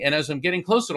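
usual speaking voice

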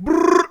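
high, loud trilled brrr; sudden high rolling whir; imitated chat flood. Imitating fast-scrolling chat noise.

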